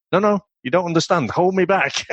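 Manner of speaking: hammed-up plea to be held back; mock insistent schoolboy. Schoolboy insists to his mates, mock pleading. Lad insisting nobody holds him.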